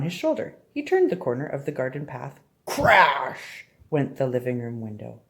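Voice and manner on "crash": drawn-out, punchy "Crash"; big storybook crash, falling in pitch; dramatic crash. A narrator's reading. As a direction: stretch the word like breaking glass. Window breaking in the story.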